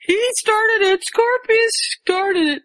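high-pitched mock tattling cry; imitated shout. Rose blames Scorpius to Neville, high mock shout. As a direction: pitch it way up, drag out the accusation. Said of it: "Rose shouts".

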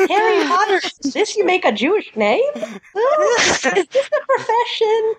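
high, kvetching Yiddish mother voice. Pretend. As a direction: play a fussing Yiddish mom.

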